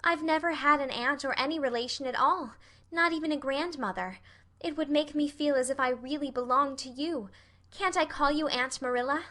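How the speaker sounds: put-on high girlish longing; wistful, pleading Anne. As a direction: plead to belong, as an orphan child.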